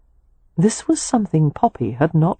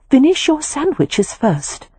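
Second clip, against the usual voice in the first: higher, slow, firm command; authoritative; 10 semitones higher, slower than usual. A narrator's reading. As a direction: order him like a nanny. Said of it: "Poppy said authoritatively".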